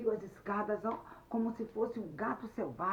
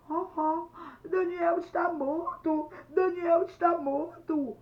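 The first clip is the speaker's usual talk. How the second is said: high, loud, slow acted wail; crying confession; 10 semitones higher, 10 dB louder, slower than usual. Luiz confesses to his mother, wailing in tears. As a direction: wail the terrible news through tears. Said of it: "ele chorando tanto, falou pra sua mãe".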